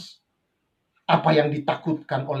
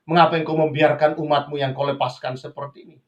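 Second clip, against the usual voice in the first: ordinary delivery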